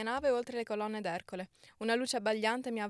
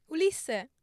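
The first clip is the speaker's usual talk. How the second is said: higher, louder cry of the name; joyful exclamation; 4 semitones higher, 6 dB louder than usual. Acted. Penelope crying out as her husband reappears, joyful and louder. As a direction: call out his name with sudden joy. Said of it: "esclamò Penelope correndogli incontro".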